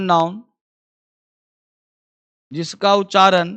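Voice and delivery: slow, word by word for writing; a teacher slowly dictating a note to pupils; slow dictation. Shown as a demonstration. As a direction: dictate each phrase slowly with pauses. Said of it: "लिखो वहां पे".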